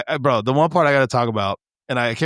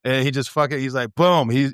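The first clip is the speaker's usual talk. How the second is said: usual speaking voice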